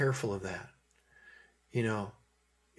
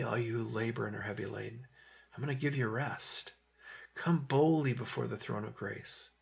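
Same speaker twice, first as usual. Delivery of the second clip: soft, soothing words of comfort; gentle invitation; 5 dB quieter than usual. Acted. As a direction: invite the weary in quietly and tenderly. Voices Jesus's comforting invitation.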